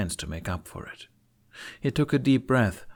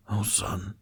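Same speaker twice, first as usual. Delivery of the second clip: whispered relief; 3 dB quieter than usual. Acted. Father overwhelmed, hugging son.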